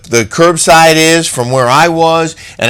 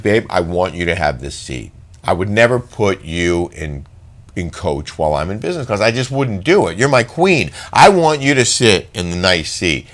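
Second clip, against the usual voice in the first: sweet offer; 5 semitones lower, 5 dB quieter than usual. Retold or imitated. Husband sweetly offers wife seat.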